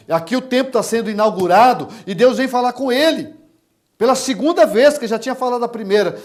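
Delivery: forceful preaching. Real, not acted. Preacher explaining Solomon's temple inauguration, forceful preaching.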